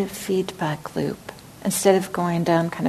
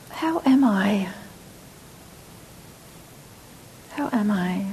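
gentle whispered check-in; tender self-inquiry in a hush; voice of kindness; 5 dB quieter than usual. Shown as a demonstration. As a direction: whisper it kindly to yourself. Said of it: "like, a voice of kindness".

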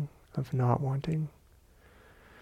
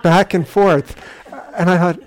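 laughing; 6 semitones higher, 7 dB louder than usual. Real, not acted. Laughing at funny memory.

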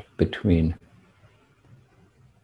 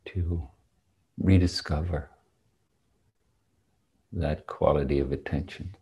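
guided meditation; 2 semitones lower, 7 dB quieter than usual. Real, not acted. Guides meditation after the bell.

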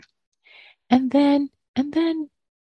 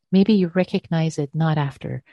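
ordinary talk, nothing marked

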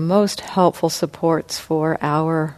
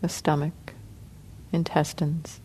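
soft, unhurried meditation guidance with long pauses; guided meditation; 10 dB quieter than usual. Acted. Teacher guiding the sitting group through body relaxation, soft and calm. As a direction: ease the group gently through each part of the body.

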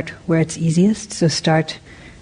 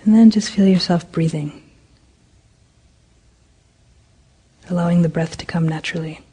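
guided meditation. Acted. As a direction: ease the group into stillness, unhurried. Leads a metta meditation.

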